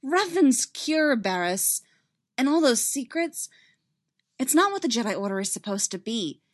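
earnest and emphatic; heated insistence. Acted. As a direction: argue the case with urgency. Heated argument in the story.

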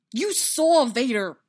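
loud, high, drawn-out accusation; forceful, upset reminder; anguished interruption. Acted. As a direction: cut in, distraught.

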